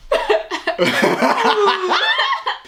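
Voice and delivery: high, hammed-up crying laugh; mock sobs that turn into laughter; laughing while crying. Imitating friend fake crying in the car, laughing sobs. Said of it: "He's laughing while he's crying".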